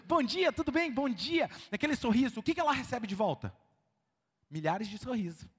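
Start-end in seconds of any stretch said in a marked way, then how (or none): 0.1-1.5 bright, smiling hello; cheerful greeting. Retold or imitated. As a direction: greet them with a big smile. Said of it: "a pessoa entra lá sorrindo".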